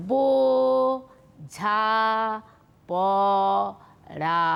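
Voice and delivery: "বো, ঝা, প, রা" split into four slow syllables with long pauses. Shown as a demonstration. Teacher to young students, showing the breath breaks, syllable by syllable. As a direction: break বোঝা পড়া into বো, ঝা, প, রা, pausing after each. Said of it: "আমার সঙ্গে সঙ্গে একবার করে একটু উচ্চারণ করো".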